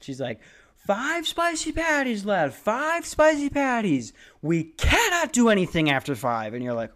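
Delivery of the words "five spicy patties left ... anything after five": manager losing it. Retold or imitated. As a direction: imitate a manager on the verge of a breakdown. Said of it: "You can hear it in the tone of her voice".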